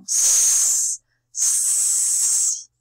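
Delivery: long, forceful "sss" to scare someone off; snake hiss. Acted. Letter C scaring away E and I, long snake hiss. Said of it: "comme s'il y avait un serpent".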